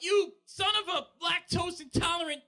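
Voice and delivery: loud, high mock curse yell. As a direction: blurt a fake curse. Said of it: "I just yell out".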